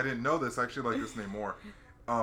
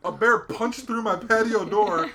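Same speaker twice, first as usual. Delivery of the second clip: higher, louder astonished dude; excited caller; 9 semitones higher, 9 dB louder than usual. Retold or imitated. Owner describes the bear video, high and loud. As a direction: tell it like unbelievable news. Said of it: "He's like".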